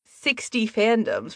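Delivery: ordinary talk, nothing marked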